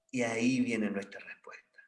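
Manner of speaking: hushed reveal, breathless and low; whisper. Real, not acted. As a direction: lean in and whisper the answer. Dramatic reveal whispered to congregation.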